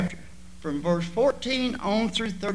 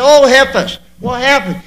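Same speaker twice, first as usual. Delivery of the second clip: pleading wail; 4 semitones higher, 9 dB louder than usual. Retold or imitated. Wailing mother pleads to king.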